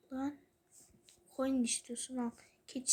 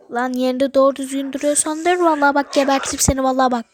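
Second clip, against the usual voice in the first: furious ranting threats; angry yelling; 13 dB louder, faster than usual. Real, not acted. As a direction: shout him down, furious.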